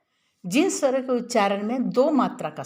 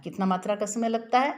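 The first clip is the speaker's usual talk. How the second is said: ordinary talk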